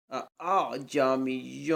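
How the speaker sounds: اعجمیٌ with a softened second hamza and long held nasal ending; tasheel. Shown as a demonstration. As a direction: recite اعجمیٌ slowly, humming out the final n. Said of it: "صرف تسہیل". (اعجمیٌ تسہیل کے ساتھ، تلاوت کے انداز میں)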